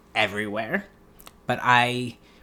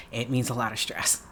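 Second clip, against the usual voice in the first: softer, flat statement; quiet aside; 2 semitones lower, 7 dB quieter than usual. Real, not acted. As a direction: say it under your breath. Answers, then repeats louder after laugh.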